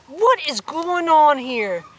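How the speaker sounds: slow, loud angry demand; furious shout. A narrator's reading. Store manager catching the crowd, furious shout. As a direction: bark it out in fury. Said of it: "charged with fury shout".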